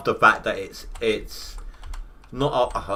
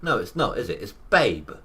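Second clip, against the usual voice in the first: normal talking voice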